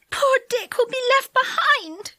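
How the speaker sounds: high tearful sob through the words; young girl sobbing for a lost friend. A narrator's reading. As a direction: let the voice break as she cries. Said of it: "she sobbed".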